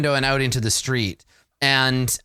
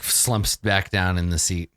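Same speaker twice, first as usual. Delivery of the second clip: usual speaking voice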